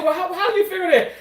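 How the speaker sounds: lightly voiced skeptical doctor; questioning doctor.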